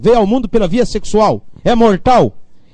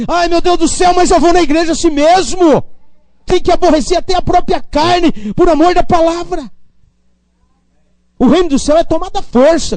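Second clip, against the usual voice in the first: fiery preaching; 7 semitones higher than usual. Real, not acted. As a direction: preach it hard to the church, pushing every line. Preacher rising to full force.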